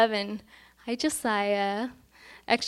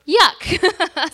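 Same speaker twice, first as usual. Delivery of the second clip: loud, high mock disgust at "Yuck."; 7 semitones higher, 7 dB louder than usual. Pastor mocks fast food to her congregation, disgusted yuck. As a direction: spit out "Yuck." like a bad burger.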